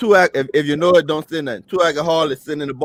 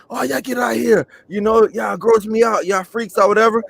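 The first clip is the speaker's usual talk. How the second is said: a hint of a disgusted bar patron; 6 semitones higher than usual. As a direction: shoo the freaks out, mildly disgusted. Bar patron voiced in a joke.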